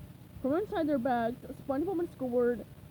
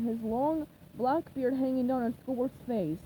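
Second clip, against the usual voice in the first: ordinary delivery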